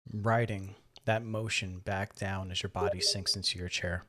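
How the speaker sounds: calm, measured voice with long pauses; guided meditation. Acted. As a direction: gently guide listeners through breath and body, softening as they settle. Leads a guided breathing meditation.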